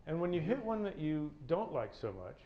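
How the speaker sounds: ordinary delivery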